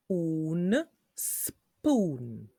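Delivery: "spoon" split into parts with a long oo; slow phonics blending of "spoon"; sounded out. Shown as a demonstration. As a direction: hold "Un" as oon, then stretch "spoon".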